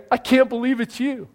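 high, emphatic happy disbelief; joyful amazement. Acted. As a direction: say it amazed and delighted, pitched well up. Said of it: "That's the joy and amazement part".

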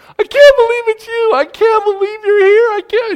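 imitating an overjoyed fiancée, very high and loud; giddy joyful disbelief. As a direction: squeal it in delighted amazement. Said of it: "she's laughing, and she's giggling".

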